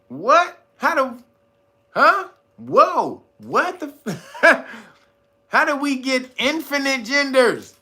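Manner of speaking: high, baffled outburst; incredulous. Acted. Reacting to most people's answers, incredulous. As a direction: blurt out disbelief with a laugh breaking in. Acting baffled at infinite genders.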